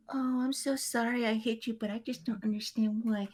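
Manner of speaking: high, prissy mock voice for the other driver; mocking the lady. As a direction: parody her fake apology. Lady voiced blaming narrator.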